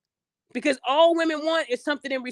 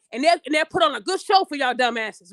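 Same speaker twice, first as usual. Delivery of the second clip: ordinary talk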